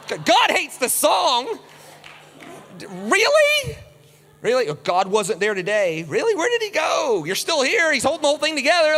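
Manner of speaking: high, loud incredulous questioning; mock disbelief. Preacher answering worshippers in mocking high disbelief. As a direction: scoff in exaggerated disbelief. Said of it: "mocking disbelief".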